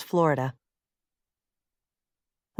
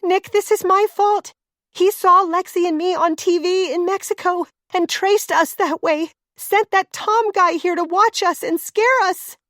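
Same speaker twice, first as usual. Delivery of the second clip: higher woman's voice, upset and guilty; Claire's voice; 10 semitones higher than usual. Acted. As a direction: play the frightened wife taking the blame.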